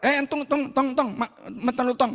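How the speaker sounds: high-pitched mock gatekeeper challenge; gatekeeping angel. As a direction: play an angel stopping someone at the door. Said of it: "Sampai pintu dicegat malaikat".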